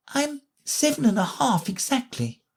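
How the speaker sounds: Alice answering the Queen precisely; proud child. A narrator's reading. Alice voiced in story.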